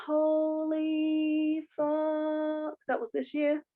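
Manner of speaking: high, drawn-out gasp of disbelief, then hushed; reliving the shock of seeing old photos; stunned disbelief. Retold or imitated. Telling a friend about scrolling her Instagram, stunned disbelief. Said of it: "I was like".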